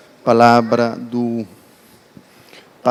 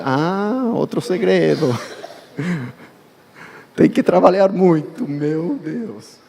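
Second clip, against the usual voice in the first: laughing; 2 semitones higher, slower than usual. Real, not acted. Laughing aside about hard work.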